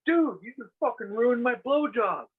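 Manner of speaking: high, mock whiny complaint; imitating George. George complaining to his girlfriend, in a high mock whine. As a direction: whine it like a sulking buddy. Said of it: "goes like this to me".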